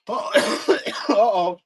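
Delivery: real coughing fit, words caught in the throat; coughing and struggling to speak; choking. Real, not acted. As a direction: choke out the words between coughs. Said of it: "I choked".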